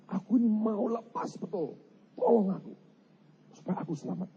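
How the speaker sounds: high-pitched plea for rescue; acted plea. Preacher voices someone begging to be saved, high acted plea.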